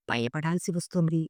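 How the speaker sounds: ordinary delivery, nothing marked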